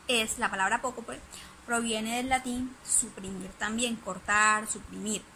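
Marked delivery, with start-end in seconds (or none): none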